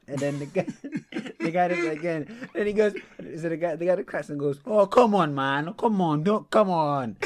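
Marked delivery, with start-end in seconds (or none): 4.7-7.2 loud, high whine of protest; imitating an exasperated appeal; imitated pleading protest. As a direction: plead like a wronged fan. Retelling klaxon man's pleading protest.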